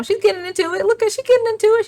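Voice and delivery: high, excited cheering on. Real, not acted. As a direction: squeal it, delighted she's joining in. Cheering on dancing character.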